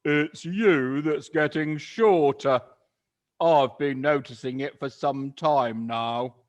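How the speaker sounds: sly Mister Twit. Acted. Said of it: "grinning horribly".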